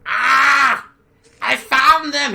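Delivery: very high, loud shriek; screaming creature. Acted. Ragged creature alerting its kin on spotting humans, screaming. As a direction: shriek it out like a frantic little creature. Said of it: "He screams in a strange Tuhulian".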